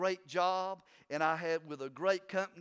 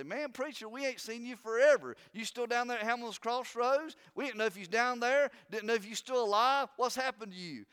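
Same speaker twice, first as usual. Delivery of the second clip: church folks' greeting; 7 semitones higher, 5 dB louder than usual. Retold or imitated. Church member greeting the preacher.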